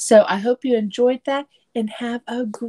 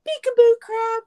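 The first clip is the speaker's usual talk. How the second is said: playful peekaboo; 14 semitones higher, 4 dB louder than usual. A narrator's reading. Playful greeting to crab.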